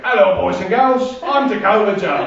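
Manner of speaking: put-on mangled mix of Welsh and Australian accent; overdone Aussie hero accent; bad Australian-ish accent. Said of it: "it sounded like this".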